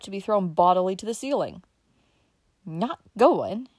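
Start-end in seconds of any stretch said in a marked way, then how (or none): 2.6-3.7 louder, shocked gasp. Acted. Uncle Bobby shocked that Dick won't attend, gasping. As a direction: catch your breath in shock. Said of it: "he gasped".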